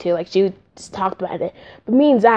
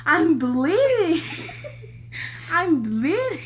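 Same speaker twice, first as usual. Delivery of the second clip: whiny pleading; 7 semitones higher, 6 dB quieter, much slower than usual. Retold or imitated. Friend repeats the plea again.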